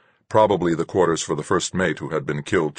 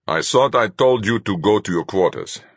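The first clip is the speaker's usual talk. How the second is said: stern rebuke; 5 semitones higher, 5 dB louder than usual. A narrator's reading. Fuchs catching him disobeying.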